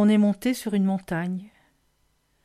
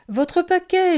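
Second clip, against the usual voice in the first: high, raised call; cried out; 9 semitones higher, 4 dB louder, slower than usual. A narrator's reading. Child calling after the sleigh about the parcel, cried out high. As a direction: call out to get someone's attention. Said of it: "ai-je crié".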